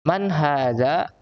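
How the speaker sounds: very slow, drawn-out question for pupils; slow model reading. Shown as a demonstration. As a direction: read it slowly so the class can follow. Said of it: "nah lihat sini".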